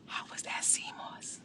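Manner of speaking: whispered, teasing C mos. Real, not acted. Creator teasing Jimmy about the sea moss, whispered. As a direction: hush it like a secret.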